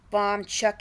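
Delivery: ordinary delivery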